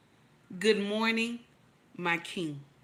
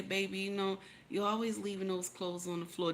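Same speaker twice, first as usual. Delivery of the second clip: soft sweet voice; 4 semitones lower, 11 dB quieter than usual. Shown as a demonstration. Wife gently telling husband in bed about the clothes, soft and tender. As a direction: lower and much softer, tender and gentle. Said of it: "Did you hear my voice?".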